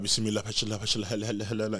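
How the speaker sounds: quiet mumbled prayer; imitating dad's prayer. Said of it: "talking about".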